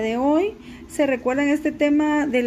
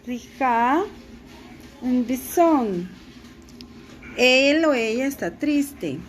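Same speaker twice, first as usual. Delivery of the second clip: ordinary talk, nothing marked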